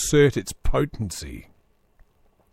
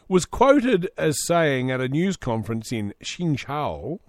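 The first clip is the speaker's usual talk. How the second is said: usual speaking voice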